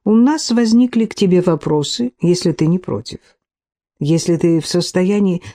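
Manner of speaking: ordinary delivery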